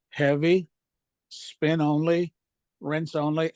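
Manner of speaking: ordinary delivery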